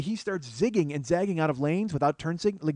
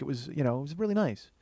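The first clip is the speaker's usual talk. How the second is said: ordinary talk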